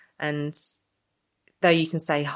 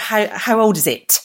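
higher, louder, slow mock of the question; mocking a shop stranger's question, higher and louder; incredulous mock quote; 6 semitones higher, 7 dB louder than usual. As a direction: repeat the stranger's question in disbelief. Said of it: "you don't expect someone to sort of".

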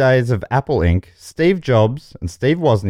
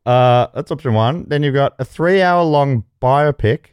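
ordinary delivery

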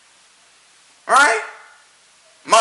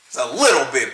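mock British 'little bit'; 7 semitones lower than usual. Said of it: "if you tell me a little bit".

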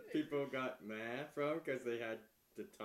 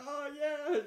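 high, loud "Oh, yeah" of a cheering crowd; mock cheering crowd; 6 dB louder than usual. Imagining the London crowd cheering, high and loud. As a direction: cheer like the delighted audience. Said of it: "They'll be like".

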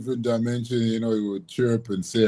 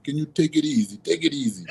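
light, imitating Spanish accent; pleading softly in a Spanish accent; 6 semitones higher, 3 dB quieter than usual. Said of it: "in his Spanish accent".

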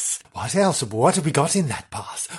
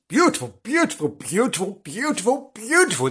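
higher, swooning gush; 8 semitones higher than usual. Acted. Host gushing over the beauty of Bethgelert. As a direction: swoon over each word.

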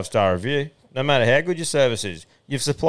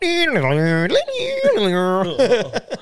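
high squealing modem imitation; long high warbling dial-up tones; dial-up modem noise; 9 semitones higher than usual. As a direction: imitate a modem screeching as it connects. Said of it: "you're dialing up".

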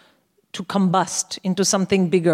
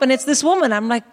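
ordinary talk

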